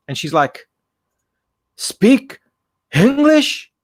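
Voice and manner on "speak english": put-on high, loud Chinese-accented question; mock Chinese accent, pitched way up. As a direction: imitate a heavy Chinese accent, halting between words. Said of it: "Chinese lady with a heavy Chinese accent".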